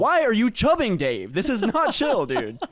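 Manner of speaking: high, loud complaint dropping on the last word; mock indignant scolding. Golfer telling off his aroused buddy, mock indignant. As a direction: scold your buddy in exaggerated outrage. Acted scolding of friend Dave.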